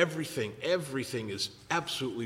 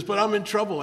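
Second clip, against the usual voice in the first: higher and louder, frantic friend; mock panic; 8 semitones higher, 4 dB louder than usual. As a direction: plead like a man in a crisis. Panicked friend voiced high.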